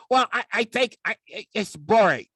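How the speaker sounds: high, loud whiny complaint; bored puppet. Acted. Puppet sidekick heckles Dave's serious talk, high and loud.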